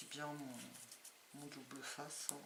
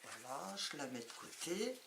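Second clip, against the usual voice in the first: slightly hoarse, rough throat; talking through a hoarse throat; 7 dB quieter than usual. Real, not acted. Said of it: "j'ai la voix enrouée".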